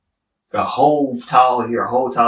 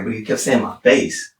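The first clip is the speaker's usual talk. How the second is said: normal talking voice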